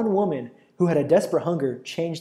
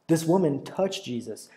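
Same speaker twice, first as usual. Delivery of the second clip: ordinary talk, nothing marked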